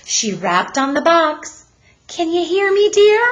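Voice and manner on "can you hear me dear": much higher and louder, calling into the box. Acted. Mother calls into the mailbox, high and loud. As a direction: call through the mailbox to her son. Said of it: "she called".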